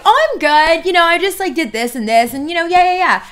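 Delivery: high, loud, eager reply; chatty good mood. Acted. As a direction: gush like someone who wants to talk. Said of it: "He wants to talk".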